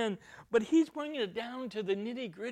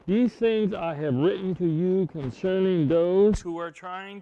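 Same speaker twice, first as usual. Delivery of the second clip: measured, louder scripture reading; 9 dB louder than usual. Acted. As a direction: read the verses out with weight.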